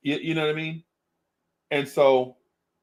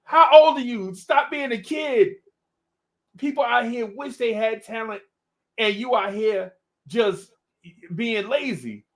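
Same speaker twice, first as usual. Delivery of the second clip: mock scolding; 9 semitones higher than usual. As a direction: tear into a lazy nephew at full volume. Scolding a lazy young rapper.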